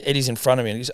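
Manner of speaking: usual speaking voice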